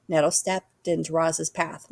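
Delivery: usual speaking voice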